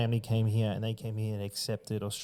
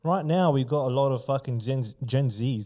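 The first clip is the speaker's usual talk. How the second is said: ordinary talk, nothing marked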